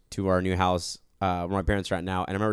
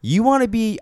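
scolding younger self; 6 semitones higher, 5 dB louder than usual. Pretend. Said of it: "I'd be like".

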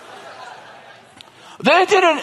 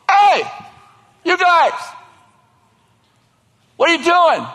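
calling out; 6 semitones higher than usual. Acted. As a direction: yell up to the enemy on the ridge. Soldiers calling up the mountain.

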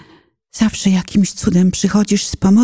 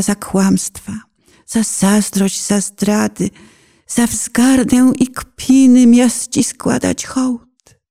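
usual speaking voice

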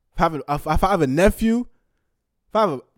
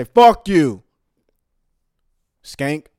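loud curse; 8 dB louder, much slower than usual. Real, not acted. Cursing out the woman.

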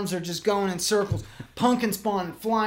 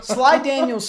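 louder, higher, slow drawn-out name; announcer-style name; 6 semitones higher, 6 dB louder than usual. Acted. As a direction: boom it like a ring announcer.

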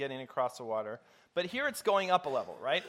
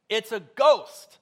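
slow, loud, higher frightened cry; terrified cry; 7 semitones higher, 13 dB louder, much slower than usual. A narrator's reading. Preacher voicing disciples crying out in fear. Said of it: "they cried out, they screamed, they shrieked in fear".

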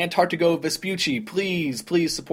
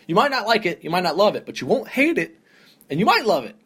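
excited insistence; 6 semitones higher, 4 dB louder than usual. Real, not acted. Hyping a show to listeners.

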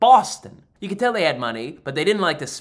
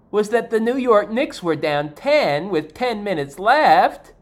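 usual speaking voice

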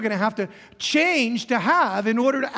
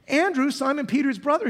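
ordinary talk, nothing marked

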